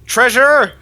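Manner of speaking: high excited call for help; shouting. Acted. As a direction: yell it out, laughing a little. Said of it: "and say".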